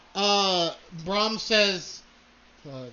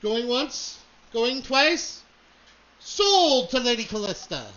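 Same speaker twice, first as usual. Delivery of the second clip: auctioneer call; 5 semitones higher, 3 dB louder than usual. Acted. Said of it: "auctioneer says".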